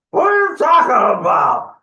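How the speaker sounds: high troll shout; exasperated troll. Acted. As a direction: yell it in high-pitched disbelief.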